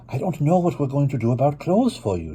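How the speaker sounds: put-on a mother fretting over her child; Mama voiced.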